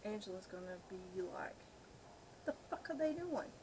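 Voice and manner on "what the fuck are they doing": normal talking voice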